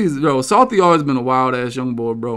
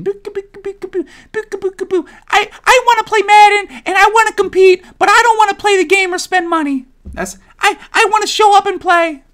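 high, louder whiny crybaby voice; mocking whiny complainer; 4 dB louder than usual. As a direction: whine like a spoiled gamer mocking complainers.

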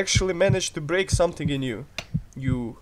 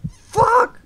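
very high shouted curse; shocked shout; 8 dB louder than usual. Real, not acted. Surgeon yelping as the patient slips. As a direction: yelp it in shock. Sudden failure.

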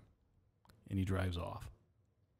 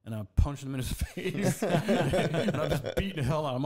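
laughing; 6 semitones higher than usual. Real, not acted. Laughing while retelling the fight.

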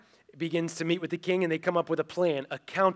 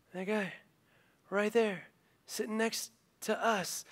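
much softer, pointed reveal; name him quietly, right beside him; hushed accusation; 4 semitones higher, 8 dB quieter than usual. Retold or imitated. Esther pointing out Haman to the king, hushed.

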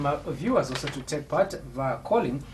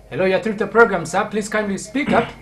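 raised, higher voice to reach a caller; calling out; 6 semitones higher, 7 dB louder than usual. Real, not acted. Host urging a faint caller to speak up, calling out. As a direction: project down a bad phone line.

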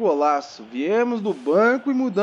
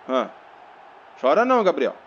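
usual speaking voice

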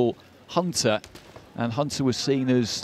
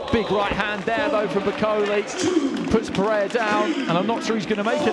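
higher, rising excitement at the knockdown; excited commentary; 7 semitones higher than usual. Real, not acted. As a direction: call the knockdown live.

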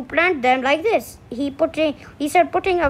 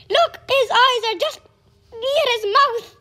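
excited; 8 semitones higher than usual. Real, not acted. Child exclaims over a picture.

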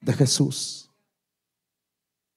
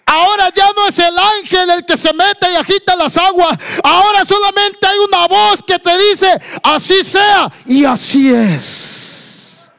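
high, loud preacher's proclamation; full-force preaching; 12 semitones higher, 5 dB louder than usual. Acted. Preacher tells the congregation of one voice, full-force preaching.

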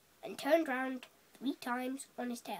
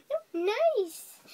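high-pitched small fish; high little fish voice; small astute fish; 7 semitones higher than usual. A narrator's reading. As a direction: answer high, as a tiny clever fish. Astute fish tempts the whale.

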